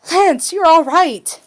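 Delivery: loud, high, slow cry of relief; relieved cry. A narrator's reading. Alora seeing Lance safe, relieved cry. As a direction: let the relief burst out.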